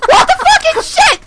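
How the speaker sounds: high, frantic screamed swearing; panicked yelling. Real, not acted. Gamer being chased by a monster, panicked screaming.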